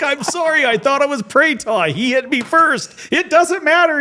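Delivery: high, giggly excuse; laughing apology. Retold or imitated. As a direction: plead through laughter.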